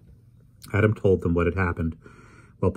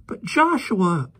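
put-on girl protesting; 13 semitones higher, much slower than usual. Polly protesting to Adam, high slow anguished girl. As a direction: stretch Joshua out in anguish.

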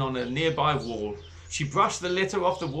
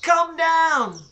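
put-on high, loud child's shout; shouting at the top of her voice; 12 semitones higher, 6 dB louder, much slower than usual. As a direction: call up to the seventh floor. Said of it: "shouted Zoe at the very top of her voice".

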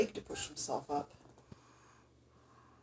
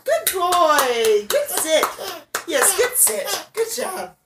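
excited praise; 7 semitones higher, 9 dB louder, much slower than usual. Real, not acted. Praising baby sitting up.

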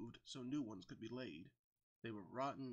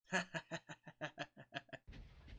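long teasing cackle, pitched up; acted string of ha-ha laughs; mocking laugh; 4 semitones higher than usual. A narrator's reading. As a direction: laugh heartily at his expense. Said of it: "and laughed when he saw".

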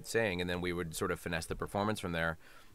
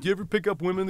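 much higher, louder imitation of fans; eager questioner; 12 semitones higher, 7 dB louder, faster than usual. Fans asking the actor about pickups, mock eager. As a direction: pipe up like a nosy fan.

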